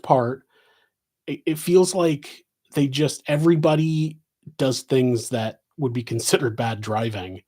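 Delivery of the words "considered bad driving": ordinary delivery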